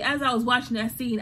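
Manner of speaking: ordinary talk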